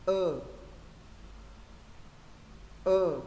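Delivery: tense the lips and sustain "Ơ"; long ơ, firm lips. Shown as a demonstration. Teacher models the second ơ for student, firm-lipped and long. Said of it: "âm này phải cứng vào".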